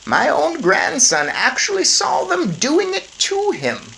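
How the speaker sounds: put-on an old lady explaining calmly; grandmother's voice. Grandmother breaks odd news to the parents, high and slow. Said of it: "my grandmother said".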